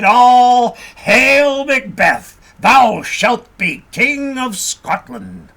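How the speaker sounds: loud, slow, solemn prophecy; grand witch's hail. A narrator's reading. Third witch crowning Macbeth with her greeting, loud and slow. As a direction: proclaim him king, drawing each word out. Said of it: "wishing to pay him a higher compliment".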